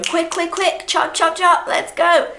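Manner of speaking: high, loud hurrying call; rushed hurry. Shown as a demonstration. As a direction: urge someone to hurry up. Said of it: "But when I'm in a hurry, I'm rushing".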